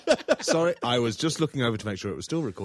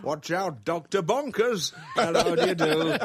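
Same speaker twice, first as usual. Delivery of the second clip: high, loud silly greeting; goofy excited shout; 10 semitones higher, 7 dB louder, much slower than usual. Acted. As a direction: ham it up like a madcap host. Goofy greeting of Doctor Bonkers.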